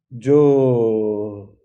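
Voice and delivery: ordinary delivery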